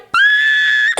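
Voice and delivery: high shrieking scream of joy; happy scream. Retold or imitated. Caller says journal found, she screams for joy. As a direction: let out a thrilled scream. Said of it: "I start screaming".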